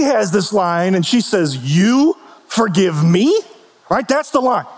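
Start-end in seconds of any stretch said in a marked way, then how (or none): 1.6-3.4 drawn-out, disbelieving question swinging up at the end; incredulous. Retold or imitated. Kathleen answering Frank's offer of forgiveness, incredulous. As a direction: stretch it out in mock disbelief. Acting the film character's offended reply.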